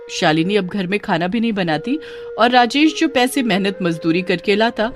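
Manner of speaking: plain reading voice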